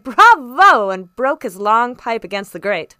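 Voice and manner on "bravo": booming cheer of approval; high, hearty shout of applause; loud cry. A narrator's reading. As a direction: cry it out with gusto. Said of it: "and the squire cried".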